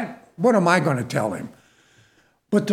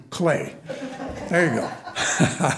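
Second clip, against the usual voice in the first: laughing; 4 semitones lower, 5 dB quieter, slower than usual. Real, not acted. Joking that Clay means dirt.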